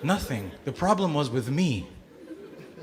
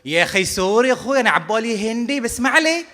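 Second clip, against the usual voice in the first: driver's reply; 6 semitones higher, 3 dB louder, faster than usual. Retold or imitated. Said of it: "And his reply was".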